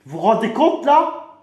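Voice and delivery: loud, high remonstrance; outraged disbelief. Acted. Candidate appalled at fascist rival, loud high outrage.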